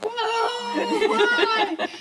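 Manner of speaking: high startled whoa, laughing; surprised. Retold or imitated. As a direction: squeak it out in mock shock. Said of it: "And I was like".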